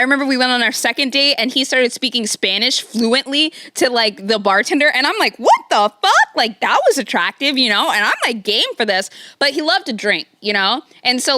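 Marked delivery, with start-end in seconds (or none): 5.4-6.1 shocked outburst. Retold or imitated. Shocked at date speaking Spanish.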